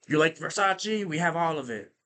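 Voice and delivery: lightly imitated Spanish accent; a hint of the hustler's pushy pitch; little accent. Hustler offering Versace, light Spanish accent. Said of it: "a little accent".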